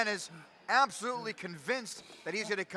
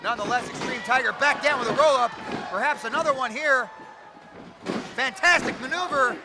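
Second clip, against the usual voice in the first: live play-by-play; 4 semitones higher, 5 dB louder than usual. Acted.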